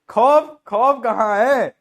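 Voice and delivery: loud, drawn-out mock demand for fear; mock menace. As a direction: play the outraged tough guy, loudly. Mock threat to laughing students. (नकली धौंस, ऊँची आवाज़ में)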